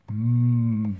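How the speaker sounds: a loud, satisfied mmm of delight; loud hum of pleasure. Real, not acted. Host tasting his buttered crumpet, loud pleased mmm. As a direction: savour it with a big mmm.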